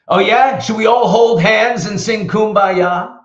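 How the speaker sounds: mock the whole idea with a laugh; salty sarcasm. Rich salty dad scoffing at the coach's no-yelling rule, loud sarcastic jeer. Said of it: "he was such a salty guy".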